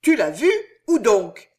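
loud, demanding roar. Acted. The queen roaring at Ruggieri for the place. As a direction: bellow the question, insisting on an answer. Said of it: "rugit la reine".

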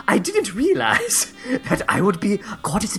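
halting, hammed-up British accent. Caught predator apologizing to Miss Sweet Pea, British accent. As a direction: stammer an apology in put-on British. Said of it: "this will be easy for us to do".

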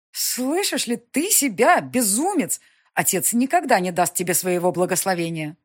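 медленно, выше и громче, в ужасе; мать в ужасе от слов сына; потрясённая мать. Acted. Mother voiced. (потрясённо, медленно)

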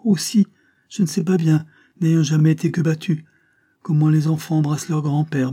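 put-on reply brightly as an eager child; lively child. A narrator's reading. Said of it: "répondit vivement l'enfant".

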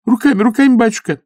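high, loud eager yes; eager agreement. Acted. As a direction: nod along eagerly. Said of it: "часто закивала женщина".

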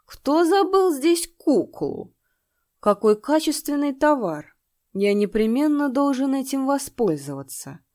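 put-on high, loud, slow drunken leer; drunk sniffling demon. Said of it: "Шмыгая носом, он произнёс".

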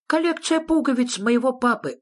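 high-pitched little creature; high character voice, querulous; wondering Sniff. A narrator's reading. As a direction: wonder aloud in a high, insistent voice.

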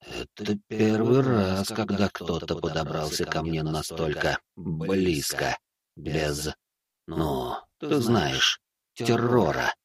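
slow, soft, echoing presence; a vast being faintly amused; ancient entity voice. A narrator's reading. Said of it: "раздался голос. Отовсюду".

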